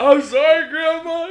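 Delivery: loud, high-pitched pleading to grandma; mock tearful apology. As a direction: wail a sorry like a punished kid. Retold apology after grandma's slap.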